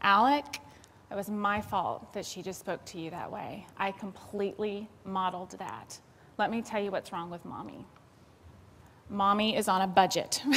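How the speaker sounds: put-on soft, low apology to a child; calm down and own the mistake; a mother softly apologizing to her son; gentle apology. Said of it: "and I said".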